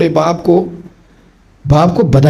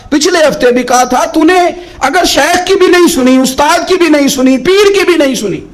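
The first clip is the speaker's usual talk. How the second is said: higher, rising to full preaching force; impassioned preaching; 9 semitones higher than usual. Acted. Preacher urging the congregation to listen, impassioned preaching. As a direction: build the exhortation higher and higher.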